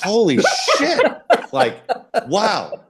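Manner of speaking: loud, high burst of disbelief breaking into a laugh; laughing exclamation. Retold or imitated. Pitcher retelling his reaction to being given Strawberry, laughing loudly. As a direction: laugh it out in shocked delight.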